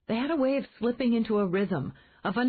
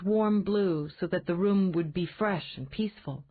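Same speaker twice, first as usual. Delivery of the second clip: ordinary delivery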